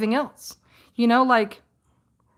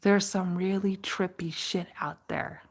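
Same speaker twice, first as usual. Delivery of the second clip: ranger's spooky tone; 6 semitones lower, 9 dB quieter than usual. Retold or imitated. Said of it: "And she goes".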